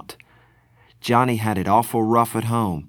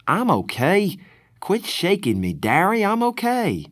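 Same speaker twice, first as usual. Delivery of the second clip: high, pressed, urgent pleading; agitated protest; 10 semitones higher than usual. Acted. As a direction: beg him to stop, voice pitched up. Dazed boy pleading with brother.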